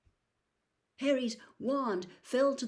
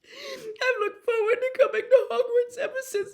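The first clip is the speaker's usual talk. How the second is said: high, loud, tearful wail; weeping; 9 dB louder than usual. A narrator's reading. Ginny weeps to Harry about being expelled. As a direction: wail it through sobs.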